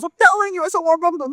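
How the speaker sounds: very high, excited protest; exasperated insistence. Acted. Man swears to doubting friend he met his idol, exasperated. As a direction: insist at the top of your pitch.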